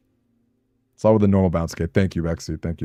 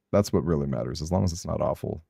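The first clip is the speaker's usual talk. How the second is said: ordinary talk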